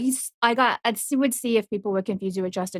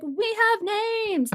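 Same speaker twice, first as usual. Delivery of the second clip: mock protest; 13 semitones higher than usual. Retold protest back at the yellers.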